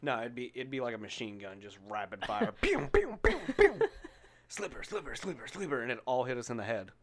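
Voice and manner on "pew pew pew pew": high, laughing gun noises; high pew sounds. Retold or imitated. Mimicking grandma's slipper barrage, high laughing pews.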